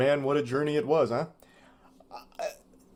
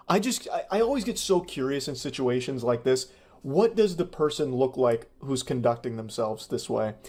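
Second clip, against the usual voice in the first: ordinary talk, nothing marked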